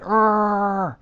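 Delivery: high strangled "arrgh"; pent-up frustrated snarl, pitched high; frustrated growl. Retold or imitated. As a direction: let out an exasperated mock growl. Mimes frustration at clueless people.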